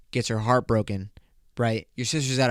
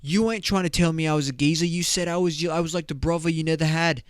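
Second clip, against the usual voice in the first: higher, protesting British lad; mock British accent; 5 semitones higher, faster than usual. Friend-zoned guy answers the sister, mock British accent. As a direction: object in a put-on British voice. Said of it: "And then the guy's like".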